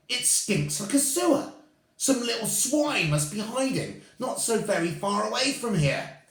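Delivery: very high, loud disgusted cries; disgusted shrieking. Acted. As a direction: shriek at the stink.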